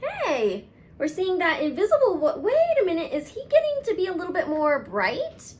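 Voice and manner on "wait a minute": usual speaking voice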